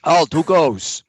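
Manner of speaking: loud barked guard challenge. Acted. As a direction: call out like a wary young guard. GM voices gate guard.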